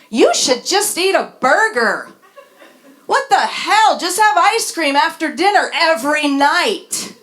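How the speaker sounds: hammed-up clueless friend; mock know-it-all advice. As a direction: sneer out the easy advice with a laugh. Mocking well-meaning advice on eating.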